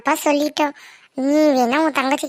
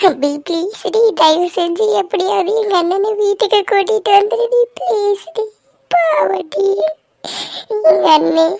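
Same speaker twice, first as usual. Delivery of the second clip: tearful begging; crying; 3 semitones higher than usual. Acted. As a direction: beg a friend, breaking into sobs. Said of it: "நீ அழுகாதடி". (அழுது கெஞ்சும் குரல்)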